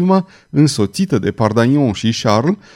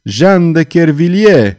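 loud, drawn-out cry; high, anguished yell; howled name; 4 semitones higher, 6 dB louder, slower than usual. A narrator's reading. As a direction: howl the name slowly and forcefully. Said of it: "urlă ea".

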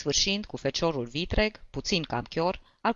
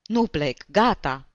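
louder, stubborn outburst; shouted refusal; 6 dB louder, slower than usual. A narrator's reading. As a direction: cry it out, refusing to board. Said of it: "strigă el". (strigăt îndărătnic)

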